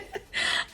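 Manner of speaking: soft unvoiced giggle; breathy giggle. Real, not acted. After finding the mirror, a breathy giggle. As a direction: let a giddy giggle slip.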